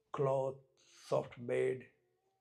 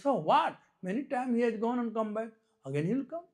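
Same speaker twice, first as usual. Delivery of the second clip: put-on high-pitched insistent child; small boy objecting; 8 semitones higher, 4 dB louder, faster than usual. Small boy insists the king will return, high and argumentative. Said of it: "Small boy, he said something".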